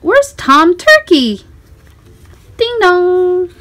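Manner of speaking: put-on lively storybook reading for a small child. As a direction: read it like a bedtime picture book, bright and inviting. Reading picture book to child.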